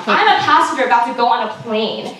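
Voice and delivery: ordinary delivery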